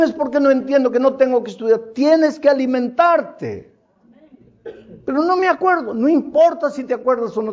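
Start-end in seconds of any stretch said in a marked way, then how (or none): 5.1-5.9 mock excuse. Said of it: "quoting the listener's excuse".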